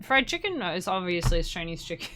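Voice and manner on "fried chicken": high questioning read-back; order-taker imitation. Restaurant worker checking a customer's order, high questioning imitation. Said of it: "they always repeat your order".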